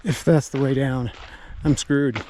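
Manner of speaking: ordinary talk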